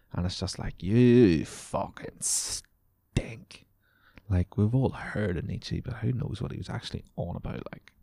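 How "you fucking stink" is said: disgusted jab. Real, not acted. Insulting the imagined hipster.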